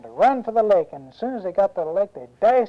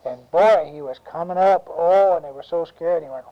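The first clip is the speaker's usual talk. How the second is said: usual speaking voice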